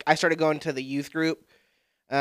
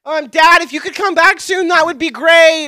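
mock plea to dad; 12 semitones higher, 11 dB louder than usual. Pleading to absent dad.

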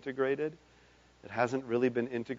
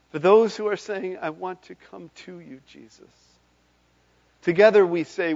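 loud, rising preacher's call; rally the congregation, louder and higher; fervent revival preacher crescendo; preaching at full force; 7 semitones higher, 9 dB louder than usual. Acted.

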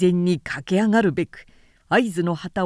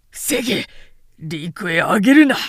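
louder barked order to hold the shore; battle shout; 5 dB louder than usual. Acted. Defender commanding his troops, loud battle cry. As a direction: yell it over the noise of battle.

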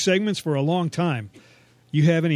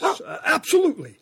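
squeaky yelp; 11 semitones higher than usual. Acted. Dad's sudden high squeak.

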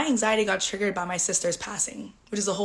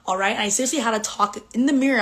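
ordinary delivery

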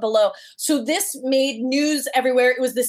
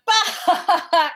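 laughing blurt; 6 semitones higher than usual. Retold or imitated. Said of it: "we both went".

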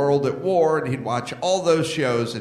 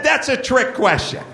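mocking quote; 8 semitones higher than usual. Quoting Kelly's dodge.

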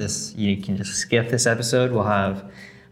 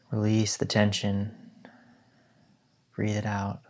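guided meditation. Acted. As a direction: guide them gently into stillness.

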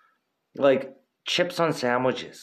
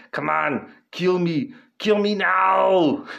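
Arnold Schwarzenegger impression; 7 semitones higher, slower than usual. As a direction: dare the monster to finish you. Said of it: "and he's like".

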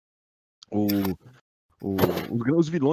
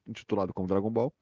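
normal talking voice

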